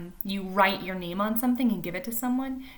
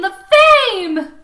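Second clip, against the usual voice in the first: peak thrill; 13 semitones higher, 17 dB louder than usual. A narrator's reading. Repeated for rising effect.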